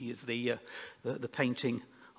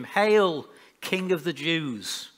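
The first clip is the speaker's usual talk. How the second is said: raised, higher proclamation, easing on the title; calling out; 3 semitones higher, 7 dB louder, slower than usual. A narrator's reading. Soldiers hail their prisoner as king, calling out. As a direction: hail him loudly, then draw out the title. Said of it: "began to call out to him".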